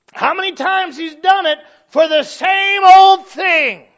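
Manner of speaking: high, drawn-out preacher's shout; preaching shout. Real, not acted. Preacher hammering a repeated point to the congregation, shouted. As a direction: belt it out slowly at the congregation.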